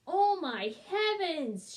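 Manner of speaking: loud, slow, high-pitched cry of alarm; mother crying out in alarm; cried out. A narrator's reading. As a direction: gasp it out in shock, drawn out. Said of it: "she cried".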